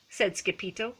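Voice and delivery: plain reading voice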